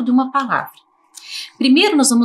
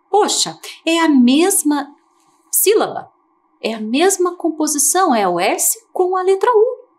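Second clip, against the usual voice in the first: mock surprise; 7 semitones higher than usual. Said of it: "teacher marvelling at same spelling".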